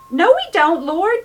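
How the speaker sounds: loud, high alarmed cry of a disciple; panicked protest. Retold or imitated. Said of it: "He was panicking".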